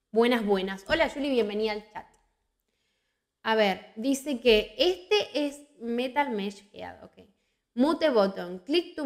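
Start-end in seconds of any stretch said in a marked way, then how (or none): none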